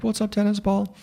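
high-pitched mocking taunt; heckling guy's voice. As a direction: imitate an obnoxious fan in a high voice. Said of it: "I heard the guy".

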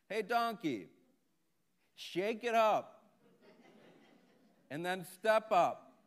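loud, high, drawn-out calling; spelled-out yell to someone who doesn't understand; slow shouted instructions. Acted. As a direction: holler it down the well word by word, slowly. Farmer shouts down, slowly, to donkey.

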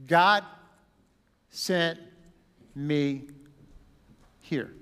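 each word set apart with pauses; a preacher hammering home the key phrase; slow emphatic words. Shown as a demonstration. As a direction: land every word on its own. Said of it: "He said it three different ways".

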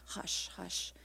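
soft hushed whisper. A narrator's reading. Someone hushing the room during the ultrasound, whispered. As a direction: breathe it out, calming.

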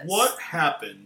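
slow, imitated narrator question; documentary voice-over. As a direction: pose it like an ominous voice-over. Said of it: "this voice comes in".

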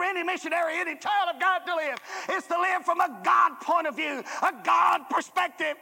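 high and loud, shouting the call; full-force preaching. Acted. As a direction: preach it to the back row.

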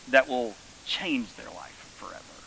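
whispered, solemn; hushed whisper. Real, not acted. Preacher whispering the meal's lasting change.

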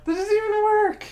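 high loud exasperated shout; outraged squeal. Real, not acted. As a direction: protest in mock outrage. Playful outrage at pun.

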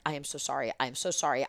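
near-tearful apology. Retold or imitated. Producer apologising to the owner's wife, quiet retold near-tearful apology. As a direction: plead quietly, a touch of tears implied. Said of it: "I'm almost tearing".

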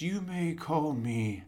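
slow, lightly voiced menace; slow menacing villain. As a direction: draw it out like a Sith. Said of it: "he says".

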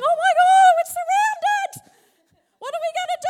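panicked. Acted. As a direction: squeal it like someone who's just seen an army. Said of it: "would we not go running back and going".

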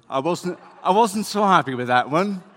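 high, loud and laughing through the words; laughing while talking. Real, not acted. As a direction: laugh it out, a little embarrassed. Laughs at own rating.

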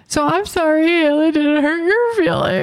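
high, cooing apology; babying mock apology. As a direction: coo it like soothing a little child. Teasing apology to the imitated friend.

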